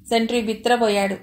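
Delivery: usual speaking voice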